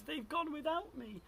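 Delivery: high-pitched little wail of Drip; plaintive cry. A narrator's reading. Drip is left behind by his family, crying out high. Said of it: "And he cried".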